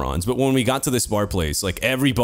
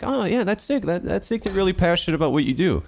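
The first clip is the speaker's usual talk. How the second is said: feigned enthusiasm; 6 semitones higher than usual. Retold or imitated. Storyteller replays praising a new acquaintance's work, politely enthusiastic. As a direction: lightly imitate being impressed.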